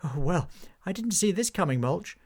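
bemused Hobgob. Acted. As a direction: shrug at the absurd turn.

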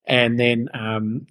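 ordinary delivery

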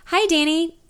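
a touch of a catch in a nervous hello; voice cracking. Acted. Narrator greets Danny on the phone, voice catching. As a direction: let the nerves crack the greeting. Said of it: "My voice catches briefly and cracks".

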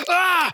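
high startled shriek; horrified cry. Retold or imitated. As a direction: cry out in horror. Horrified reaction.

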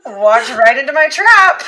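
loud, triumphant teasing; gleeful gloat. Acted. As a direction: crow over the win. Teasing gloat after joke.

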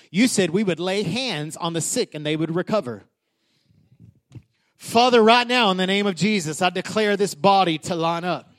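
ordinary delivery

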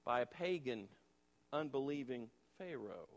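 usual speaking voice

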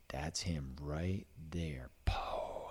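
slow, hushed whisper while aiming; a tense hunter's whisper. Retold or imitated. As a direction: breathe it so the deer won't hear. Tense moment aiming at buck.

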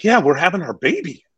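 retold proud new-father announcement; excited announcement. Client calls agent from the hospital, excited.

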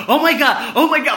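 imitated panic, high and loud; freaking out. Mom woken with bad news, freaking out. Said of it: "She just freaks out".

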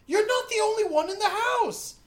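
high, loud telling-off; imitated scolding mom. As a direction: snap like a fed-up mother. Voices mom scolding the kid.

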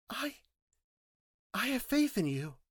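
put-on high girl's voice, faltering at first; tentative, high-voiced declaration of faith; hesitant trust. Girl falteringly declares her trust.

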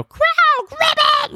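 very high, squeaky frog croak. Shown as a demonstration. Father making the frog croak for his son, very high and squeaky. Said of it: "I make the frog croak".

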